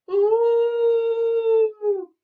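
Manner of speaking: high, loud, hooting ghostly cry; ghostly ooh. Acted. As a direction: wail a spooky moo from far off. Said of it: "came the ghostly cry".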